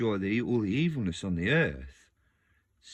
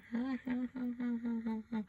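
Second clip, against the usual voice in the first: very high mock-evil cackle; sinister laugh; 4 dB quieter than usual. Imitating the horror film grandma, high sinister laugh. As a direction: cackle high like a possessed granny. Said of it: "Starts laughing".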